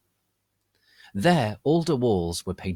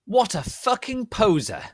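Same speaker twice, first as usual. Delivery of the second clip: high, louder scornful outburst; indignant teen yell; angry shout; 12 semitones higher, 4 dB louder than usual. Acted. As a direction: spit it out in disgust. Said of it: "Draco shouted angrily".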